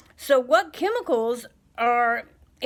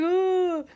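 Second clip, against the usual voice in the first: high, crying ooh; mock weepy whimper; 10 semitones higher, 5 dB louder than usual. Mom retelling her weepy first week, high crying whimper. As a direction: whimper as if about to burst into tears.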